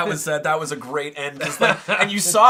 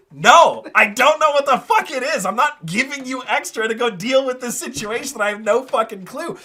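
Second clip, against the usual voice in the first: annoyed coatl; 6 semitones higher, 7 dB louder than usual. Retold or imitated. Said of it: "the coatl's like".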